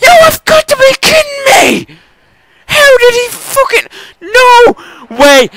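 very high, loud crying yells; real anguished shrieking; outraged cry. Real, not acted. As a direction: wail in disbelief at the screen. Third tombstone, disbelieving outrage.

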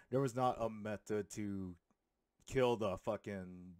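ordinary talk, nothing marked

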